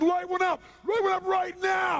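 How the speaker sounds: high, full-force yell; loud, unhinged drill-sergeant command; crazed screaming order. Retold or imitated. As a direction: scream it like a raving madman. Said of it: "I start acting crazy".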